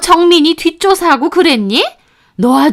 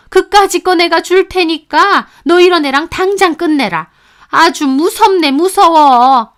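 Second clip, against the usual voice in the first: higher and louder, contemptuous mother-in-law scolding; tongue-clicking scolding; 6 semitones higher, 5 dB louder than usual. A narrator's reading. Mother-in-law throwing daughter-in-law out of the house, scornful scolding. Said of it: "혀를 차면서 저를 나무라시더군요".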